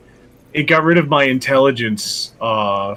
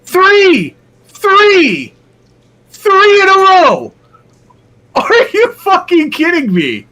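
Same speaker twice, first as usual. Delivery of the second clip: high, loud yell of disbelief; outraged yelling; 13 semitones higher, 6 dB louder, slower than usual. Real, not acted. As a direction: yell your exasperation at the dice. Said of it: "you ain't yelling hard enough".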